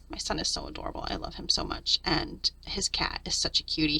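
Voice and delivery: whispered, gushing over a sleeping pet; soft whisper. Real, not acted. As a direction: hush it so you don't wake the cat. Gushing softly over son and cat.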